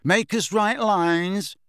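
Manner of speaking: high, slow, smirking taunt; smug mocking suggestion. A narrator's reading. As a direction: tease with a drawn-out jeer.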